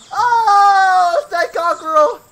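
high, loud theatrical cry; over-the-top mad scientist yell; dramatic shout. Acted. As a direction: proclaim it like a mad villain.

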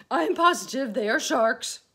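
high and loud, certain; frightened insistence. A narrator's reading. Earthworm insisting to the group, frightened. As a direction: insist with rising fear. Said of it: "said the earthworm".

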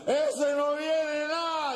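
put-on high, drawn-out drunken heckle; heckling drunk. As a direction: call it slowly from the back of the crowd. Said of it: "Dice el borracho".

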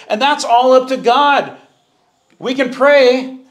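preaching at full force. Acted. Preaching climb.

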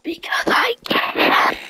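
strained whisper, drawn out slowly; mock whisper. Gamer defending his whispering to his friend, exaggerated slow whisper. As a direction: stretch out a hammed-up, squeaky whisper. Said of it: "Why are you whispering?".

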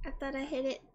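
laughing. Real, not acted. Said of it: "I thought I did".

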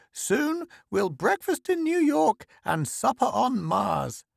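put-on very high, starry-eyed old lady; excited old woman. A narrator's reading. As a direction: pipe it high, carried away by the idea. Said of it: "The old woman's eyes glowed".

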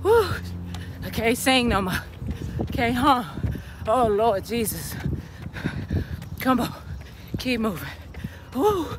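panting uphill on a run; breathless, pushing up a steep hill; out of breath. Real, not acted. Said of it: "my legs are burning".

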